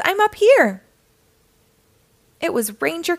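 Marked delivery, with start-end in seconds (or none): 0.1-0.8 high, loud call from afar. A narrator's reading. Ranger on the mountain to visitors below, calling out. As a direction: holler down to people below. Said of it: "called a voice".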